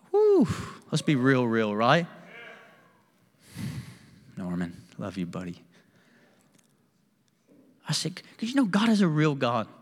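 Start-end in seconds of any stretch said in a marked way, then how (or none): none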